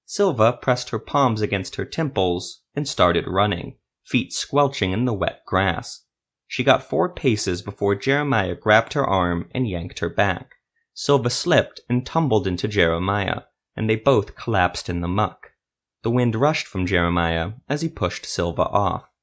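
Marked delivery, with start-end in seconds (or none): none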